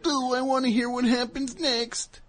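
high whiny complaining voice, slow; whiny kid voice. Pretend. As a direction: whine like a disappointed kid. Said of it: "Aren't you just like".

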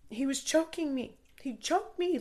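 high, loud frantic cry for help; panicked screaming. Retold or imitated. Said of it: "like, just screaming".

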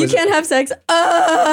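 high, loud mock outrage ending in a yelp; mock outraged yelp. Hosts mocking the no-sex rule with a shrill mock wail. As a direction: shriek it like the news is a tragedy.

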